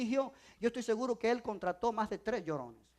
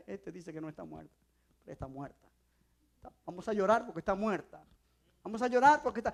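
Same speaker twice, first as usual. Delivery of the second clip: mocking mourners; 6 semitones lower, faster than usual.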